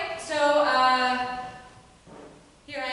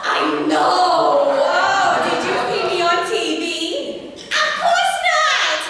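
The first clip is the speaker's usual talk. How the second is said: excited gushing; 3 semitones higher, 8 dB louder, slower than usual. Acted. Housewife boasting to Belinda about testifying, loud excited gushing. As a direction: gush loudly, then shriek the last words.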